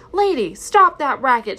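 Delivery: irritated aunt snapping at the barking dog; snapped. Acted. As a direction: bark it at the noisy dog. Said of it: "she snapped".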